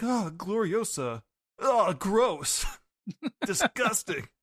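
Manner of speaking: high, slow, disgusted whine; voicing Roger grossed out. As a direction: push her away in mock disgust. Roger recoiling from Gloriosa.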